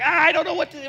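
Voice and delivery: high flustered yelp of a lost new dad; mock panic. New father holding his goo-covered baby, mock panic. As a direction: act out a dad with no clue, voice jumping up.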